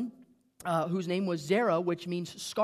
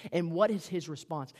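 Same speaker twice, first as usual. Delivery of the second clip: normal talking voice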